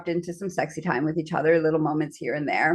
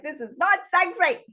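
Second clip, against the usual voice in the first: high-pitched mock protest; lightly acted exasperated partner; retold objection; 11 semitones higher than usual.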